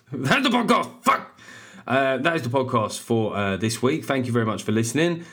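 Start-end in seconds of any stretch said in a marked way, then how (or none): 0.1-1.4 raised, excited sign-off shout; real shout. Real, not acted. Podcast host signing off, shouting. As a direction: belt out the closing line. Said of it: "I know I'm shouting".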